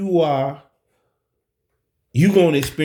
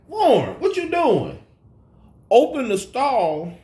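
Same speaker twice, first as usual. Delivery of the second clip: calling into the stall, startled; surprised call; 10 semitones higher than usual. Retold or imitated. To Warren in the bathroom stall, a high startled call.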